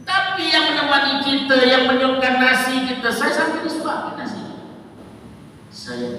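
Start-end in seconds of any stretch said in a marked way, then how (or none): 0.0-4.4 high, loud fiery preaching; preaching at full force. Acted. Preacher scolding the congregation, loud fiery preaching. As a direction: hammer the point home.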